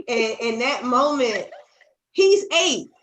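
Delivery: high, loud, half laughing and half crying; breaking into laughter with a catch of tears; laughing through tears. Real, not acted. Telling a friend about her son, laughing through tears.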